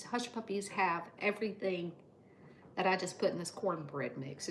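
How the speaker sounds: ordinary talk, nothing marked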